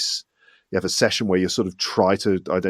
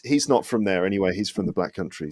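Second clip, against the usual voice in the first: ordinary delivery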